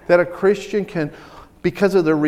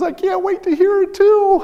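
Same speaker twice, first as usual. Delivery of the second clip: high-pitched eager quote; excited; 13 semitones higher than usual. Retold or imitated. Quoting her sister eager to hear the new voice, excited. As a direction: squeal it with eager anticipation.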